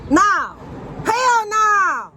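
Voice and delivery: sudden high loud shout; startled yell. Real, not acted. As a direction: yell at the cat in alarm.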